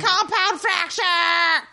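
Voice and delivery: high, drawn-out mock yell; exultant proclamation, played for laughs; mock shouting. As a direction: cry it out in hammed-up ecstasy. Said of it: "shouting random words".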